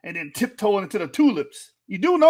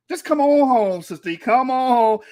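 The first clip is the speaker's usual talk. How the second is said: mock altar call; 3 semitones higher, 6 dB louder than usual. Preaching invitation to Sister E.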